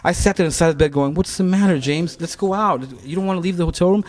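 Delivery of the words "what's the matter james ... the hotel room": higher, lively cajoling; coaxing. Retold or imitated. Friend coaxing James out in Berlin, cajoling. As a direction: coax a sulking friend out. Retold coaxing in hotel room.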